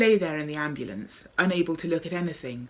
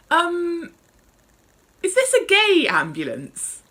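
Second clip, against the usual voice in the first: high, hesitant call from the stretcher; slightly nervous call; 13 semitones higher, 8 dB louder, slower than usual. Acted. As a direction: call out nervously, a little louder. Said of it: "I called out, slightly nervously".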